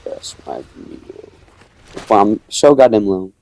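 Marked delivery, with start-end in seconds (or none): 0.1-1.3 slow, low, hushed yes my meal; a drawn-out predator's murmur; gloating whisper. Retold or imitated. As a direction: savor it like a hungry predator. Gloating over triple kill.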